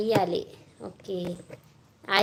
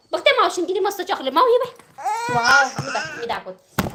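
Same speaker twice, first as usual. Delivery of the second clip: high, fast, singsong coaxing; 7 semitones higher, 4 dB louder than usual. Real, not acted. Calling toddler to see chocolate.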